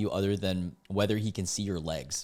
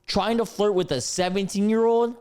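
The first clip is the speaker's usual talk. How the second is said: loud, scandalized incredulity; appalled disbelief; 10 semitones higher, 4 dB louder than usual. Real, not acted. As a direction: exclaim aghast, pitch jumping up. Appalled at the age gap.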